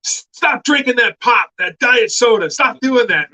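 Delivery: lightly voiced actor scolding a sick friend; mock scolding. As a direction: wag a finger through the camera. Actor's scolding video message voiced.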